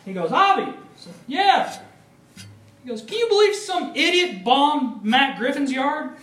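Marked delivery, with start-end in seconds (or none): 0.3-0.6 loud high call across the house; dad calling out to his son. Retold or imitated. Dad calling son's name. 1.3-1.8 loud high shout back; called answer. Retold or imitated. Answering dad's call. 3.0-6.1 higher, louder incredulous dad; dad calling out about the news; excited dad. Retold or imitated.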